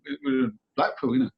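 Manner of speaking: usual speaking voice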